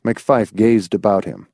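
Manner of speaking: plain reading voice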